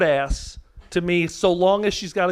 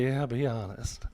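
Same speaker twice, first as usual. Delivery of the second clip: flat deadpan; 8 semitones lower, 12 dB quieter than usual. Retold or imitated. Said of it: "She went".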